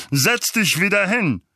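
loud, high command; Onkel Vernon, bellowing. Acted. Uncle orders Harry back to his seat, bellowing. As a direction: order him about at full voice.

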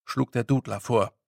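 ordinary delivery, nothing marked

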